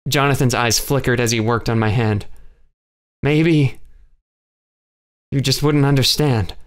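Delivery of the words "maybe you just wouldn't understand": ordinary delivery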